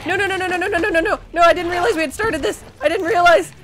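high, loud frantic pleading; panicked protest. Real, not acted. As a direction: protest in a real flustered panic. Caught unready as fight starts.

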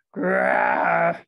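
deep, loud bear roar. A narrator's reading. Bear roars at Baba Brana tugging his fur, deep and loud. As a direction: let out a huge low roar. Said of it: "Old Bear roared".

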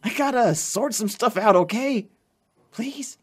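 high, loud urgent begging; anxious pleading. Acted. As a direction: beg him to keep it secret. Begs friend to keep secret.

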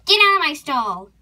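loud sudden order; angry yell. Acted. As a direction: yell the intruder out. Horse orders intruder out.

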